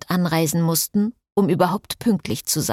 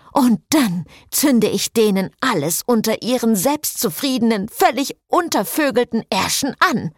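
furious cursing; 6 semitones higher than usual. Acted. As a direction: spit out the curse in a rage. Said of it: "fluchte Cecile". (wütend fluchend, höher und lauter)

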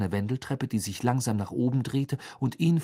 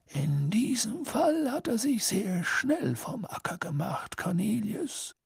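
put-on slow, high, airy old wizard's voice; gentle, drawn-out composure; calm; 13 semitones higher, slower than usual. A narrator's reading. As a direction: answer unhurriedly in a thin, half-whispered voice. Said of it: "sagte Dumbledore ruhig".

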